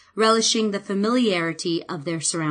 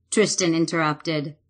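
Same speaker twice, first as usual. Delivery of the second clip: ordinary delivery, nothing marked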